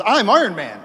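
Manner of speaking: Tony Stark proudly revealing himself, loud; bold declaration. Retold or imitated. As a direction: announce yourself to the world. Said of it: "Tony Stark says".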